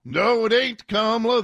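a touch of a mock Southern shout; mock Southern accent, mildly. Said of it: "speak like you stereotype Georgians".

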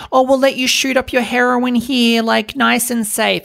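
mock-sweet sarcastic official voice. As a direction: parody a smug official, sweetly. Mocking authorities' permissive tone.

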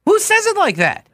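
very high-pitched indignant disbelief; incredulous. Real, not acted. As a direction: protest the pronunciation, pitch soaring. Incredulous at singer's pronunciation.

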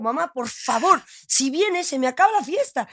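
high, earnest retold plea; pleading with mom. Telling her tearful mother to stay at the party, high pleading.

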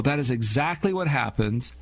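normal talking voice

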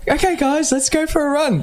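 very high, peppy put-on voice; mock fitness-app host. Teasing partner's energetic mornings, in a high mock-peppy voice. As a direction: imitate an over-energetic morning workout host. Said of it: "No, I'm not the app".

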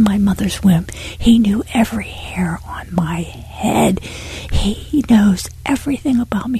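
real, breathless whispering through tears; tearful whisper. Real, not acted. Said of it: "And I started crying".

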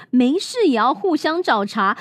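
plain reading voice

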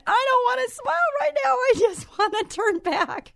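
very high, plaintive wail with a nervous laugh; high pleading mock whimper; whiny scared protest. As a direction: beg to go back like a frightened kid. Scared reply to photographer.

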